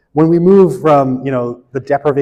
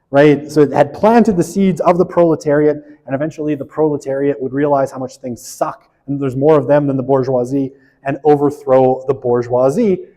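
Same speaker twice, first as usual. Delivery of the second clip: normal talking voice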